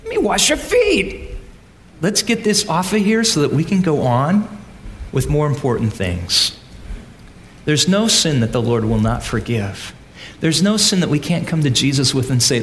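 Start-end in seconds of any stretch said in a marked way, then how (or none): 0.0-6.5 God speaking tenderly. Retold or imitated. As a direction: lift the pitch and offer to wash their feet. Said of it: "God's saying".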